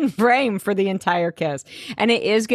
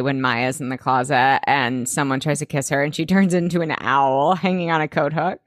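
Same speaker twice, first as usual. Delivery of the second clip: normal talking voice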